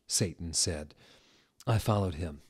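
plain reading voice

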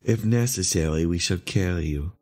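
low, restrained threat; controlled, ominous leader; grim. A narrator's reading. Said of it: "said the leader grimly".

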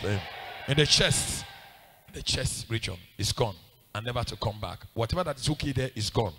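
low-pitched declaration of healing; healing prayer. Real, not acted. Preacher declaring the pain gone in Jesus' name, low. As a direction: pronounce it low and steady.

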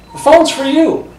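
high call across a party. Retold or imitated. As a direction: sing it out high to someone far off. Dreamed person calls across a room.

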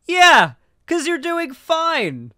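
sarcastic mock reply. Streamer mocking a pundit, slow sarcastic high voice. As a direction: mockingly tell him he's doing fine, slowly. Sarcastic retort to Walsh's claim.

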